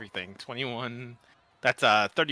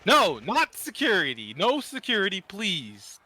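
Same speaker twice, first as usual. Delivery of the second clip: higher, protesting plea; urgent pleading; 6 semitones higher than usual. Acted. Player begs screaming office worker not to call security, pleading.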